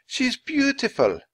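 high, slow admiring; tender. Acted. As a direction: coo over a baby animal. Kathleen admires the penguin.

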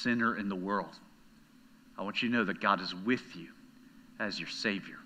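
comforting hush after the build; tender quiet preaching. Acted. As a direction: bring it down gently to the listener. Litany drops soft and low.

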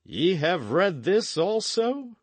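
slow, high-pitched, restrained outrage; Earl's incensed question, drawn out; angry demand. A narrator's reading. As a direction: demand it slowly, pitch climbing to the end. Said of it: "He lowered like an angry lion".

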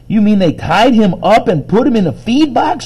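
high, loud indignant outburst; all excited. A narrator's reading. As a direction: burst out in disbelief, pitched high. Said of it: "got all excited about that".

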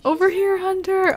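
high, singsong mock call; high-pitched pretend hail; playful calling out. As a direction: pretend to call someone over. Voicing someone calling to Hunter.

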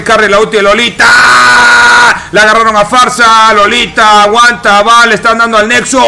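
urgent shouted game call; excited play-by-play. Real, not acted. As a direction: drive the final push loud and high. Live call of the final push.

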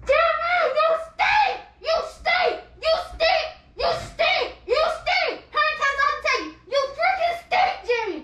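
high-pitched, repeated complaint; worked-up, punchy telling-off; exasperated scolding. Real, not acted. Exasperated rant at smelly partner.

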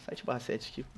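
ordinary talk

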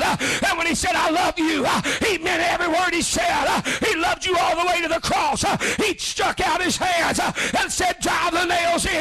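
high, driving revival preacher cry; full-force preaching. Real, not acted. Preacher urging the congregation to come to Jesus, full-force preaching. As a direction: preach in high sustained shouts, punching each phrase.

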